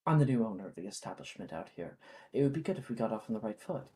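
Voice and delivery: low, soft, fast measured reply; calm owner. Acted. New shop owner answers the thugs, low and composed. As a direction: keep it cool and firm, rattle through it. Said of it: "And she says".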